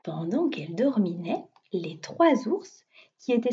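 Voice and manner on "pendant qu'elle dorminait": ordinary delivery, nothing marked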